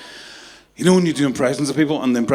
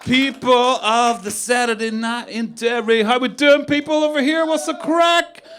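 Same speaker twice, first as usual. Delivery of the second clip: hyped-up compere impression; 10 semitones higher than usual. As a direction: pump up the crowd like a cheesy host. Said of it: "I just did a really good impression of somebody".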